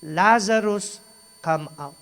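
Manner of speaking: Lazarus called out loud; mild commanding call; retold call. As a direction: call him out of the tomb. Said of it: "Sumigaw siya".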